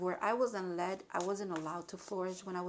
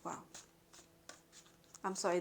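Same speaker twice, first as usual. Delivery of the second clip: normal talking voice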